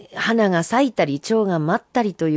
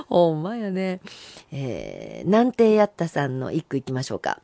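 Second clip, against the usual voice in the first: ordinary delivery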